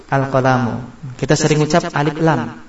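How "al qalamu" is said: al-qalamu ending on plain MU, no N; article added, nunation dropped. Shown as a demonstration. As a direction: open al-qalamu with AL, finish on bare U. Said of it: "Qalamun menjadi". (al-qalamu, akhirnya mu tanpa tanwin)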